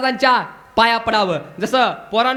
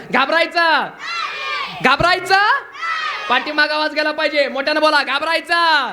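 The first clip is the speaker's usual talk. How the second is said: high, rousing call to a crowd; loud call; 4 semitones higher than usual. Shown as a demonstration. Preacher wants children to answer louder, high loud call. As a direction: call it out higher for the children to echo. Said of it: "आवाज जरा जोरात येऊ द्या".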